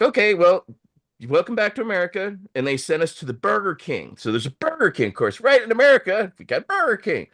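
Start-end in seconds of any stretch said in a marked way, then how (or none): 0.0-2.4 high and fast, breezy mock-official greeting; mock welcome. Border agents waving them back in, high breezy mock welcome. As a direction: rattle it off like a cheery resigned official. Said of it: "And so they're like".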